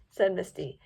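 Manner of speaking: ordinary delivery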